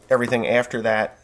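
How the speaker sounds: ordinary talk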